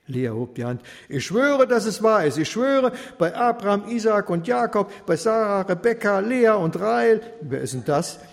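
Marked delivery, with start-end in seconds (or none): 1.1-7.2 high, loud solemn swearing; girl swearing to convince her friend; emphatic oath. A narrator's reading. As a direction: swear it with all force.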